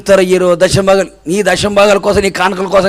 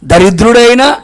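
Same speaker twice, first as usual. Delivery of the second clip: ordinary delivery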